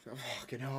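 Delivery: breathless swear; out of breath. Real, not acted. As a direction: gasp it out after the stairs. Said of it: "I've just run up the stairs and I'm out of breath".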